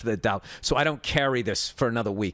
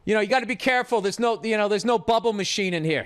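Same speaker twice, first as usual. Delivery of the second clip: hammed-up higher patronizing voice; mocking taunt; 7 semitones higher than usual. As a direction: needle him with fake concern. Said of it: "He was saying".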